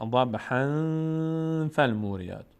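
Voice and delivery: slow chanted ضبحاً with a long nasal before فالموریات; nasal ikhfa. Shown as a demonstration. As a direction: hold the n of ضبحاً in the nose. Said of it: "این حکایت در این آیه".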